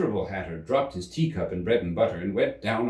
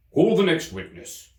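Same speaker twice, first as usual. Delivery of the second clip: louder, higher command; commanding King; 9 semitones higher, 5 dB louder than usual. Acted. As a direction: summon the next witness.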